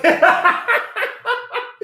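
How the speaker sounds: long, high-pitched burst of laughter. Real, not acted. Host amused that Chuck is not slick, laughing. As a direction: keep cracking up, high.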